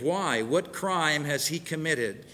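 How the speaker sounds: usual speaking voice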